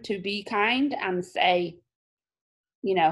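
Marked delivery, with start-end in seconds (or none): none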